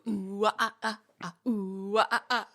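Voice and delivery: mock crooning oohs and ahs; imitating second singer. Co-host imitating the next singer joining a flash mob, crooning. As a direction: croon loose silly vowels. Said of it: "and then somebody else, like".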